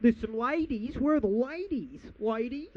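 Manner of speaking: imitating Tony Abbott. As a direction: lightly ham up a clueless politician hunting for women. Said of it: "he's like".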